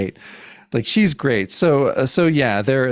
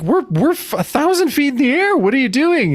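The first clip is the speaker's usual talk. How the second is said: higher, alarmed mock panic; 12 semitones higher than usual. Villain alarmed that Cap jumps, higher mock panic. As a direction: squeak it out like she can't believe it.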